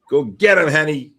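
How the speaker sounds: loud, high cheer urging the dog on; loud cheering call to the dog; excited cheer. Real, not acted. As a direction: egg the dog on, loud and pumped.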